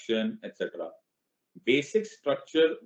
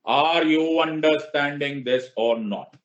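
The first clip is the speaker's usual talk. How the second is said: slow, louder classroom question; drawn-out question; 4 semitones higher, 5 dB louder, much slower than usual. Shown as a demonstration. Teacher quizzing his students, slow and pointed. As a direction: stretch it out to make the class answer. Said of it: "teacher checks the class".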